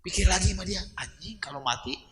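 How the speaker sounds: hushed, breathy whisper; whispered aside. Acted. Preacher whispering the saint's thought to listeners. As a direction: lean in and whisper it. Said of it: "storytelling hush".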